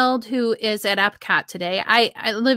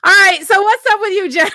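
bright host call; 10 semitones higher, 6 dB louder than usual. Real, not acted. Host calling on next guest.

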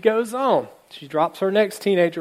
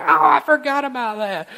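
sudden realization; 8 semitones higher, 4 dB louder than usual. Retold or imitated. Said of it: "And Jean's like".

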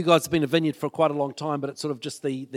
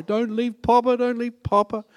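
high whiny pretend sob; fake crying; 8 semitones higher than usual. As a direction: wail it like a crybaby grandpa. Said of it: "I fake cry when they go".

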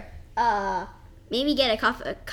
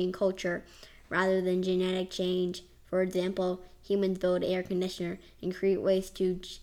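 low, even AI reading voice; robotic reading; 4 semitones lower than usual. Acted. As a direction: read it like a computer answer. Reads Chat G P T answer.

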